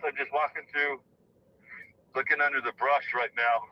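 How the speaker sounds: ordinary talk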